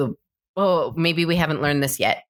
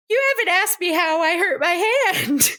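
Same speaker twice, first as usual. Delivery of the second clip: sly high voice; 13 semitones higher than usual. Retold or imitated. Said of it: "It's just like".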